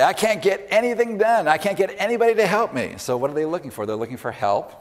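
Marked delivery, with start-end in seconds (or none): 0.0-2.9 much higher and louder exasperated complaint; frustrated complainer. Retold or imitated. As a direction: whine it like someone at the end of their rope. Voicing a frustrated complaining person.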